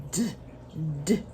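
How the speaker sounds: D as a short "duh", not the letter name; isolated letter sound. Shown as a demonstration. Teacher giving children the first sound of dog, D as short "duh". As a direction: tap out D as a brief plosive "d", pause between. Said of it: "What sound?".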